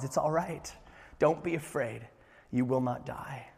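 very soft, calming voice; hushed, tender comfort; gentle reassurance. Retold or imitated. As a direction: soothe someone frightened, barely above a murmur. God reassuring a terrified Gideon.

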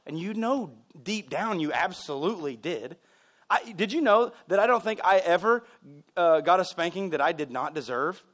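ordinary talk

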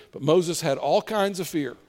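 ordinary delivery

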